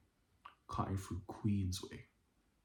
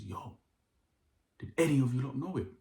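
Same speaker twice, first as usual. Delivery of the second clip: imitating a confrontational demand, raised and quick; retold hostile challenge; 5 semitones higher, 5 dB louder than usual. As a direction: act out his aggressive challenge, louder and higher. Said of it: "he's come with this violent energy".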